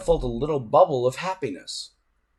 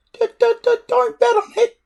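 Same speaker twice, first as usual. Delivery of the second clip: very high, squeaky stutter; a little dragon stammering out a boast; tiny stammering dragon; 7 dB louder than usual. A narrator's reading. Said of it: "said Toothless".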